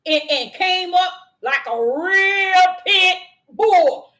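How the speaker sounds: slow, loud growl of a charging dog; loud, slowed snarl of a dog charging the fence; charging pit bull. Retold or imitated. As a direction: come on like a real pit bull. Preacher acting out charging dog.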